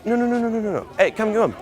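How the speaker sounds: high, rapid-fire repeated commands; urgent. Shown as a demonstration. Pickup coach demonstrating pulling a girl away, urgent. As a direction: hurry her over with urgency. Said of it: "adding a sense of urgency".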